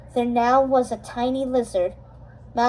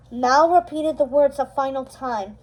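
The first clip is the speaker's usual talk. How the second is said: ordinary delivery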